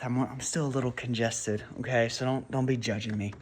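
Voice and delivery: slightly winded from the stairs; out of breath. Real, not acted. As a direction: talk a bit short of breath. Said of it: "I just walked upstairs, so if I'm, like, out of breath".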